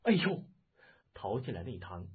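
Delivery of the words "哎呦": high startled yelp; startled cry. Acted. Wife seeing a severed head, a startled cry. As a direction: cry out in sudden fright. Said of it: "包希若惊叫".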